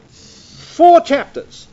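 four said slow, high and loud; four stretched out and punched; stress on four. Shown as a demonstration. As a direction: hit the word four hard and slow. Stresses number of chapters.

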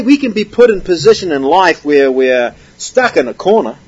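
usual speaking voice

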